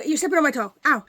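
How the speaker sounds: sudden loud ouch-voice protest; loud complaint. Real, not acted. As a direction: complain loudly as the dog treads on you. Dog stepping on her foot.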